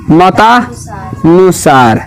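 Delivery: "मतानुसार" very slow, broken into मता, then नुसार; slow word model. Shown as a demonstration. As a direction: draw out "मतानुसार" in two parts with a pause between. Teacher models joined word in parts. ("मतानुसार" बहुत धीरे, टुकड़ों में)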